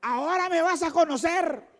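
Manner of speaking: high, taunting voice; mock provoking wife. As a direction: dare him to hit you. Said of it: "las mujeres dicen". (esposa provocadora, voz aguda y burlona)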